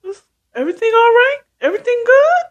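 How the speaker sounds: high, fussing mother's voice; concerned mom. Retold or imitated. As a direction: check on everyone anxiously. Mother checking on the room.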